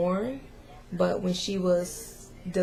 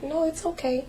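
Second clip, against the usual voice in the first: put-on higher, gentle mother soothing a small boy; comforting child; 9 semitones higher than usual. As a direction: soften it like calming a toddler. Mother comforting her son.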